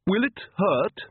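high, timid little voice; nervous question. A narrator's reading. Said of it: "said the Countess nervously".

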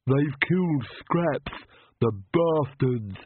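slow, lisping servant voice in grief; grieving Igor. Acted. Igor over his dead dog, slow grieving servant voice. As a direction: mourn the dog slowly, thick-voiced.

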